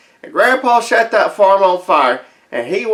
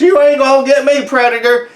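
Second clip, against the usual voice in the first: taunt; 7 semitones higher, 4 dB louder than usual. Retold or imitated. Taunting predator while running.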